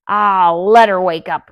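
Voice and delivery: loud, dismissive grouch; Grumpy refusing to keep his voice down; loud grumble. A narrator's reading. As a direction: wave it off loudly. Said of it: "not to speak so loudly".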